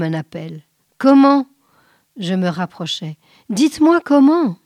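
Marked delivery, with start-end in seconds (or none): 1.0-1.5 higher, loud, eager question; urgent question. Acted. Man begs vision for the way. 3.5-4.6 higher, louder, insistent plea; urgent plea. Acted. Man begs vision for the way.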